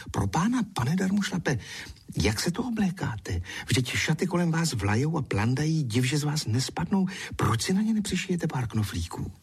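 high-pitched indignant scolding; appalled, exasperated questioning. Acted. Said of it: "jí ten pohled tak rozčílil".

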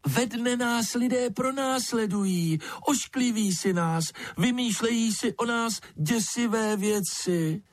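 high, tiny creature voice; thin piping bat. A narrator's reading. Bat explains to lizard why people are feared, thin piping voice. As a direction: keep it piping high all through. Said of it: "hlásek tenký jako pípot ptačího mláděte".

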